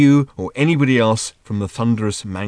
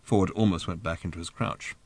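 ordinary delivery

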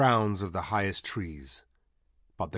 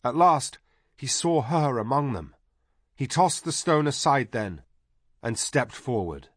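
usual speaking voice

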